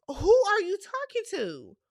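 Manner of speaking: high, loud puzzled question; mock confused. Reacting to the scene, a loud high baffled question. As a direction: act baffled at who he means.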